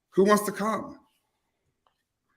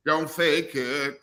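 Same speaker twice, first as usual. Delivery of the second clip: slow, hushed announcer tagline; mock ad voice; much slower than usual. Host parroting the ad's tagline as a slow voiceover. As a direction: purr the slogan like an ad voiceover. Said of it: "That's a real commercial".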